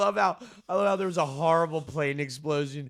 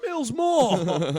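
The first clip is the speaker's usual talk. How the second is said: rowdy bro yelling; 10 semitones higher, 5 dB louder than usual. Retold or imitated. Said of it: "And you're in the".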